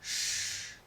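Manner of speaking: "sh" sordo, como mandando callar; sonido sh. Shown as a demonstration. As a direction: sopla "sh" con mucho aire. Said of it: "como si mandáramos callar". ("sh" como pidiendo silencio)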